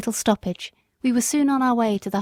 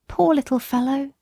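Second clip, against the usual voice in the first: lightly raised pleading mother's voice; a poor mother begging help, gently higher; young country mother; 4 semitones higher than usual. Acted.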